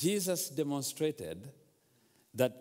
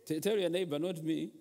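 ordinary talk, nothing marked

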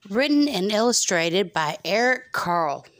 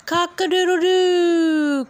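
high, loud, slow crowing; rooster crow; 11 semitones higher, 6 dB louder, slower than usual. Acted. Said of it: "crowed the rooster".

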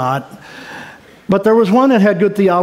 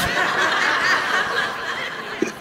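breathy giggle at his own joke; own joke laugh; 11 semitones higher, 9 dB quieter than usual. Real, not acted. Preacher laughing at his own Fedorov joke. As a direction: laugh at your own silly line.

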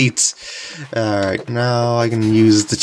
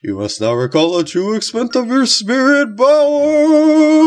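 wrestling announcer; 11 semitones higher than usual. Pretend. Reads game spirit dialogue again.

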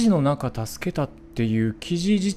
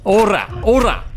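taunting shout; 7 semitones higher, 9 dB louder, slower than usual. Real, not acted. Shooting the enemy.

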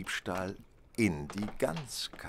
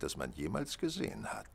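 sly whisper; 8 semitones lower, 6 dB quieter than usual. Acted. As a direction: drop to a knowing whisper. Detective teases the thief.

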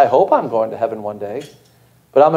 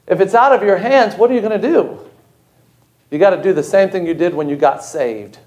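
usual speaking voice

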